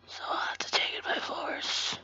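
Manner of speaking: sneaky whisper. Acted. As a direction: hush it like a plotting villain. Mock sneaky threat to cat.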